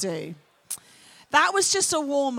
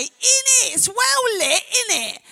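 raised London street slang voice; London accent; 8 semitones higher, 4 dB louder than usual. Shown as a demonstration. Londoner shows the crowd how London talks, London accent. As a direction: demonstrate London slang, starting high and falling. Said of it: "and in London we say".

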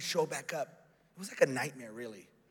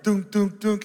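loud, higher "tung" sound effect; imitated impact noise; 6 semitones higher, 9 dB louder, slower than usual. Preacher showing the moment it hit him, loud sound effect. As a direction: punch out each film-style strike loudly. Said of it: "that's what it was like".

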